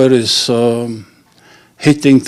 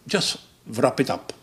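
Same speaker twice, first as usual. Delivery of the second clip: much softer, flat punchline; deadpan; 10 dB quieter than usual. Acted. Fed-up customer ending the condom purchase, softly deadpan. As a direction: mutter it, fed up.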